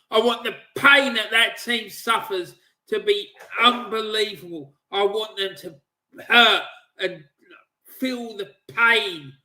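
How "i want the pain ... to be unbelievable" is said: usual speaking voice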